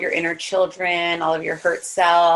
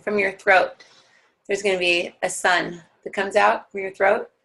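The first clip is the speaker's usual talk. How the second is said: guided meditation. Acted. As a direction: ease the class down into their hearts.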